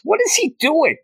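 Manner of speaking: very high incredulous question; mock bafflement. Onlookers watching Peter just mixing chemicals, high mock bafflement. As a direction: squeak it out in disbelief.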